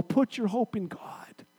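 slow, hushed whisper. Acted. Preacher quoting David speaking to his soul, slow whisper. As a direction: breathe it to your own soul.

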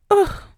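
big frustrated sigh and groan; loud exasperated ugh. Real, not acted. Fed up with carrying everyone's emotions, loud ugh. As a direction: let it all out in one ugh.

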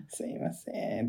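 soft, slow, whispered sorry; meek unvoiced apology; timid apology. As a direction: shrink back as the scolded clerk. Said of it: "めっちゃ怒るやんと思って".

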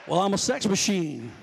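a hint of a put-on stud; mock braggart. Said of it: "This is how you all talk".

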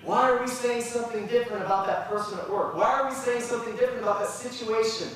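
high, loud, rising preacher's cry; preaching at full force. Real, not acted. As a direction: build the sermon to a passionate peak. Preacher builds to a climax.

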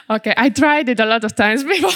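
quick, laughing through the words. Real, not acted. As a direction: laugh it off, a little embarrassed. Laughs after attempting a name.

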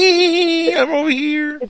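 put-on very high, loud, giggly child voice; giggling little kid. Mimicking a hiding child who calls out to the adults, very high and giggly. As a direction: squeak it out like a kid who can't stay hidden.

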